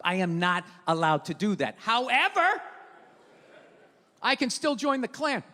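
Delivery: normal talking voice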